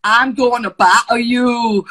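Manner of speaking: a hammed-up Scottish accent, loud and slow, laughing; a wobbly, laughing try at a Scottish accent; bad Scottish accent. Shown as a demonstration. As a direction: attempt Scottish accent, cracking up. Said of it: "Don't wanna do a Scottish accent".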